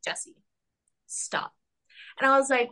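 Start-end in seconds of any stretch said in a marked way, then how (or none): 0.0-1.5 soft scolding hush; a mother's stern, soft-spoken hush; hushed mom warning. Retold or imitated. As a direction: stop her quietly so nobody hears. Mom hushes her at a restaurant.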